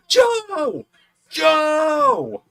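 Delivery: high, loud drawn-out shout; calling out. Retold or imitated. Fans in front shout for Joe Hendry's attention, calling out. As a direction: imitate fans hollering across an arena.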